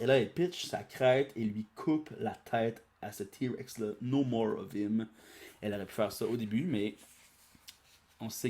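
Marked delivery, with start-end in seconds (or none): none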